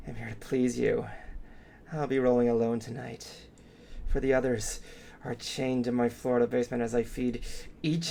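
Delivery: low, sinister horror host; menacing midnight host, savoring each word; creepy host voice. Acted. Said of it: "your creepy voice is so good".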